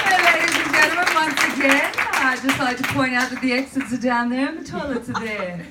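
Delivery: high, bright-and-loud airline greeting at the start; cabin crew voice. Acted. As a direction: put on an over-polite flight attendant announcement. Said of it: "this is how everyone spoke of Virgin Blue".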